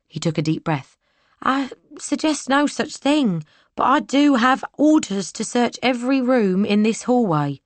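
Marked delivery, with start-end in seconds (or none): 1.4-7.6 put-on young soldier. Soldier answers the maid.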